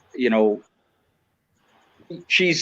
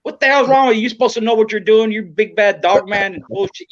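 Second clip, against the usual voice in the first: scolding wife; 8 semitones higher than usual. Retold or imitated. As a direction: play his wife ripping into him after he loses. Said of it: "she's like".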